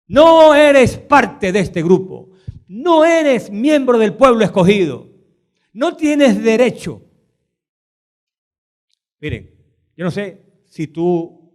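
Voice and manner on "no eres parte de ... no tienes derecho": loud, slow exclusion; loud slow rejecting voice; voiced rejection. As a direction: hammer each no home. Preacher voicing the rejection.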